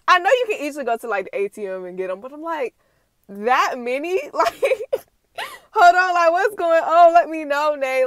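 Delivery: laughing through the words in disbelief. Real, not acted. Reacting to Nay's stash, laughing in disbelief. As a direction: crack up while asking what's going on.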